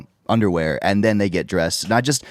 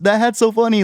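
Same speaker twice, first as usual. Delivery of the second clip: excited quote; 13 semitones higher, 4 dB louder than usual. Retold or imitated. Quoting strangers praising hat.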